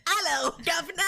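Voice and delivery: high, hammed-up British accent; terrible British accent. Imagining the soot-covered engineer as British, terrible British accent. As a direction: do a bad, mock British greeting. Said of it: "terrible British accent".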